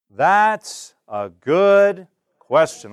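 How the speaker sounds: slow, steady teacher model of a reply; slow model answer. Shown as a demonstration. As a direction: model it calmly, word by word. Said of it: "Here's your response".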